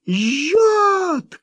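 high yelp of burning pain; cry of pain. A narrator's reading. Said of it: "крикнул он и снова взвыл от боли".

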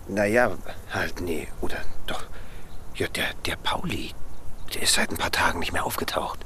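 hushed, secretive whisper; conspiratorial whisper. Acted. Neighbour whispering a suspicion to the pensioner. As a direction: lean in and whisper the gossip.

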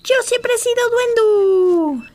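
high, loud, slow triumphant yell; shouting. Acted. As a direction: yell it out while running off. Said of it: "gritó".

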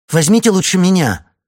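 high, loud panicked plea. A narrator's reading. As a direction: cry out in panic. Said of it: "В её голосе слышится паника".

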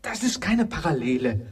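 viel höher, abwehrend erschrocken. Acted. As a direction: wehr den Gedanken entsetzt ab. (erschrockener, hoher Ausruf)

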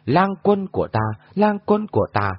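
mocking echo, pitched high; high echoing repeat from hiding. A narrator's reading. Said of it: "có tiếng trầm âm phát vọng ra".

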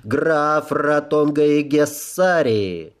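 slow, ringing formal announcement; herald. Acted. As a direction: announce the guest to the hall. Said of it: "высокий звучный голос Герольда".

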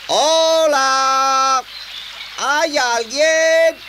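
long, high, loud shout; drawn-out call for anyone there. Acted. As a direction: holler into the distance, stretching the words. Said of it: "Probaré a gritos".

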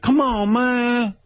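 put-on high, whiny street-kid begging; high street pleading. Choked by Irvin, begging him in a high street voice. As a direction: beg the bully to let go. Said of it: "And I'd be like".

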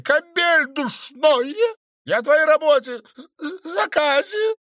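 loud, high, stuttering scold; high angry scolding. A narrator's reading. Stranger scolds the count about the job, high angry stammer.

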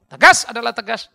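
loud emphatic preaching shout; forceful shout. Real, not acted. Preacher shouting to fathers about firmness. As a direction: hammer it home at full voice.

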